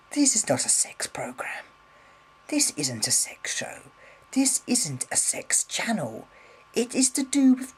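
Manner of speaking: whispered, pointed denial; a firm whispered insistence; emphatic whisper. Real, not acted. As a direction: hiss it under the breath, firmly. Insisting channel isn't about sex.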